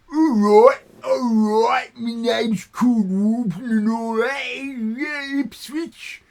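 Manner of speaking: loud, high hammed-up Cockney geezer; mock Cockney. Mocking how a friend talks, loud exaggerated Cockney. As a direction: blare it like a London football lad. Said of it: "How do you talk?".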